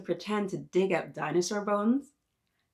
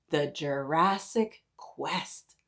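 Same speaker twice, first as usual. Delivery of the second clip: slow hushed whisper; mysterious whisper; 3 semitones lower, slower than usual. Acted. Reading the book title to kids, slow mysterious whisper. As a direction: breathe the title like a secret.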